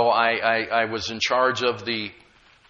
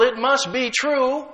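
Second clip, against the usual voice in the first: imitating doubters; 8 semitones higher, 4 dB louder, slower than usual. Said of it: "But most people say".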